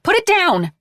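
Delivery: panicked rebuke; alarmed. Acted. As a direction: cry out in fear. Mare alarmed at pointed gun.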